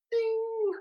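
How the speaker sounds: high sung bell ding; ping it out like a bell going off; bright bell ding, idea struck. Retold or imitated. Signals the idea landing.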